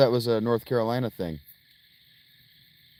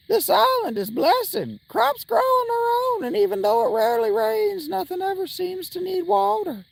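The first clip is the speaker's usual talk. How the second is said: a cheery hill-country villager, pitched way up; mountain villager accent. Acted. Villager greeting the player, in a high put-on mountain accent. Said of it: "talk like they're from the mountains in North Carolina".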